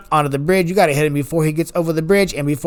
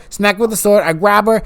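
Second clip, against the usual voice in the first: usual speaking voice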